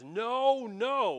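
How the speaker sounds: "no, no" both high, loud and drawn out; emphatic double no. Shown as a demonstration. Preacher reading the Greek text, "no, no" loud and emphatic. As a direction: stretch and punch each "no". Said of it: "doesn't just say no".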